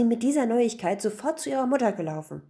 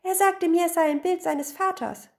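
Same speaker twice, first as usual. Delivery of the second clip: high, loud complaint; high-pitched upset outcry; crying out; 10 semitones higher, 4 dB louder than usual. A narrator's reading. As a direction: protest as a wronged wife. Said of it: "rief die Gattin".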